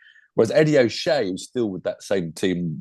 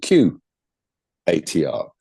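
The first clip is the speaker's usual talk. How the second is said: spelling out; 4 semitones lower, 3 dB louder, slower than usual. Shown as a demonstration. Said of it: "How do you, how do you spell".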